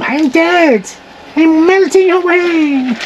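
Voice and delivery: high, slow, melting away; dying slug voice. Pretend. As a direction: camp dying slug wail.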